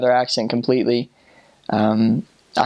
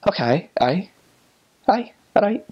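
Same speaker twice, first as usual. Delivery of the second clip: higher, Scottish-tinged acknowledgement; Scottish accent; 7 semitones higher than usual. Acted. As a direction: agree with a Scottish lilt. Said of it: "when I talk to someone who's Scottish".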